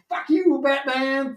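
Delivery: put-on high jeering kid voice; high, mocking kid shout. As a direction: jeer like a bratty child. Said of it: "All the kids saying".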